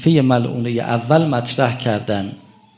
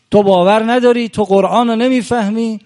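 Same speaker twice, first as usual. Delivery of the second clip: higher, louder scolding; angry rebuke; 9 semitones higher, 5 dB louder than usual. Retold or imitated. Omar berating the companions, higher and louder scolding. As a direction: scold them as if they know nothing.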